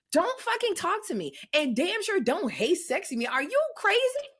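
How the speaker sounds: indignant telling-off. Retold or imitated. Woman scolding an imagined catcaller, indignant. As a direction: tell him off, climbing to an incredulous question.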